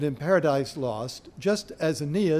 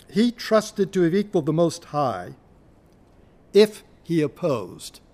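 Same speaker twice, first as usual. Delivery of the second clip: poetry reading; 2 semitones higher, 5 dB louder than usual. Shown as a demonstration. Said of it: "So, at line forty".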